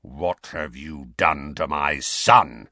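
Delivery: low, slow menacing growl. A narrator's reading. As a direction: threaten him low and slow. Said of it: "he said in a menacing growl".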